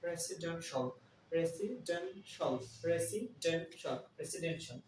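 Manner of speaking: residential drawn out slowly, ending "den-shal"; slow teacher drill of residential as re-si-den-shal; model pronunciation. Shown as a demonstration. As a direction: stretch residential out slowly for learners. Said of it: "Third one is residential".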